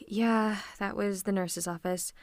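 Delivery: ordinary talk, nothing marked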